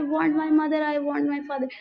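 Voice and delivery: ऊँची आवाज़ में रोते हुए चिल्लाना; बच्चा माँ-बाप के लिए ज़ोर से पुकारता है; shouted. A narrator's reading. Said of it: "And shouted his double pitched strain". (खोया बच्चा भीड़ में मम्मी-पापा के लिए चिल्लाता है, ज़ोर से)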